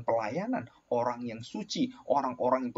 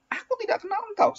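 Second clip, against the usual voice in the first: much higher, stern voice of God; God's rejection. Acted. Preacher voicing God to the wicked, high and stern. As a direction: cast them off from on high. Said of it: "Tuhan katakan apa?".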